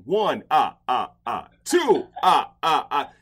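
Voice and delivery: mock Count counting out thousand-dollar tickets. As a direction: count the fines like the Sesame Street vampire. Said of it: "I'm like the Count from Sesame Street".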